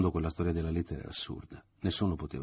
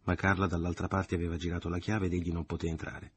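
plain reading voice

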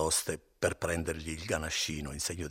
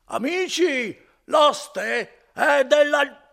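put-on much higher, much louder, slow drunken bellow; yelling louder; 14 dB louder, much slower than usual. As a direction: call up the stairs to everyone. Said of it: "cominciava a urlare più forte ancora".

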